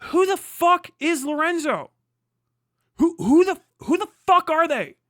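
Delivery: real fury at missing biographies; angry shout. Real, not acted. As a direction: yell the question at the writer. Ranting at unknown influencers.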